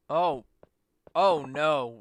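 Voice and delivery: ordinary delivery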